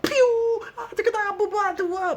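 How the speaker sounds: very high squeaky yelp then gabble; hammed-up panicked gibberish; frightened gibberish. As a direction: squeal and babble in terror. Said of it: "this Japanese guy's like".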